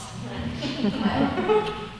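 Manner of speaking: soft, self-amused chuckle; quiet giggle at a failed accent try; small laugh. Real, not acted. Laughs at own accent attempt.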